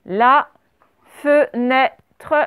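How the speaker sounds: "La... fe-nê-tre", each syllable separate. Shown as a demonstration. Teacher lets pupils hear every sound, syllable-by-syllable "La fenêtre". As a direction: break "La fenêtre" into slow, distinct syllables. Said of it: "Écoutez bien tous les sons dans chaque syllabe".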